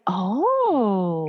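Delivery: long drawn-out falling oh; reacting "oh". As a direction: draw the oh out slowly. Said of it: "I was like".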